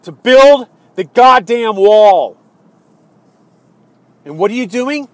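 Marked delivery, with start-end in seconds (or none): 0.0-2.4 loud, slow, hammered words; furious shout. Real, not acted. Angry voter demanding the promised wall, slow furious shout. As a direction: drive each word home angrily. Furious rant addressed to Trump.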